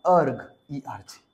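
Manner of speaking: slow affectionate "अर्ग, ERG"; said lovingly. Shown as a demonstration. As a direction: coo the unit name like a pet name. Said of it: "इसको हम प्यार से कहते हैं".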